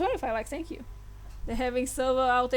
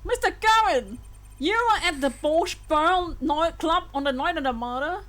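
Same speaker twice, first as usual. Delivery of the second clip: raised, higher voice of astonishment; shocked courtroom exclamation; 4 semitones higher than usual. Acted. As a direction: exclaim it, acting stunned by the testimony. Voices shocked reaction to testimony.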